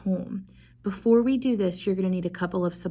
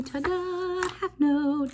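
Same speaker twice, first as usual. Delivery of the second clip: ta-da reveal; 10 semitones higher, slower than usual. Acted. Teacher reveals the half note.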